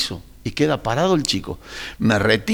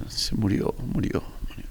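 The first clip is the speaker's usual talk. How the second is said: imitando un anuncio casi sin voz; noticia de muerte susurrada; 9 dB quieter than usual. Retold or imitated. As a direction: relata la muerte en un hilo de voz, muy bajito. Familiar anuncia una muerte al pastor. (susurro apagado al imitar al familiar)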